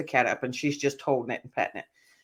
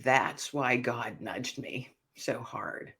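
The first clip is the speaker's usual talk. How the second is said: very slow, soft and low; retold awe, drawn out and subdued; hushed realization; 5 semitones lower, 8 dB quieter, much slower than usual. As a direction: let it sink in quietly.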